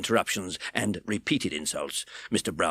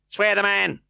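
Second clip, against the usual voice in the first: grudging growl; 12 semitones higher, 7 dB louder than usual. A narrator's reading. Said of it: "growled Mister Fang with a very ill grace".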